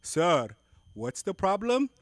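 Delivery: hammed-up Indian call-centre agent; Indian accent. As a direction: play a Mumbai support rep, loud. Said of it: "agent in call".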